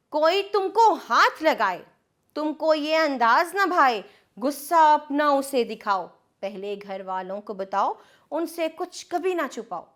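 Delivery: put-on sing-song reading of a children's rhyme; lilting nursery-poem delivery; poem recitation. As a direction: recite it rhythmically for small kids. Poem verse continues.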